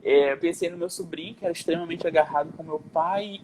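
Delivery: ordinary talk, nothing marked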